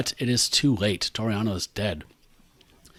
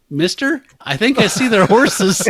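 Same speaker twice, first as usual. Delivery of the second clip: put-on laughing kid voice; 8 semitones higher than usual.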